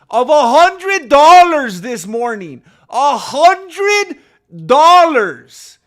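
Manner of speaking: can't believe the gift; amazed excitement. Real, not acted. Streamer telling chat about a donor's hundred dollars, amazed.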